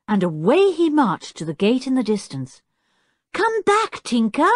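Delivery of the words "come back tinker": high-pitched urgent call; raised, high girl's shout. A narrator's reading. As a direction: call after someone to turn back. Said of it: "shouted Anne".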